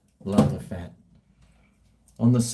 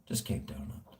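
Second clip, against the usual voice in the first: whispered repeat; 15 dB quieter than usual. Shown as a demonstration. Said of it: "I just keep doing it".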